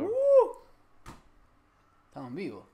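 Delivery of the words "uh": loud, very high "uh"; short soaring whoop; high-pitched whoop. Real, not acted. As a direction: let out a high hyped whoop. Hype call before going live.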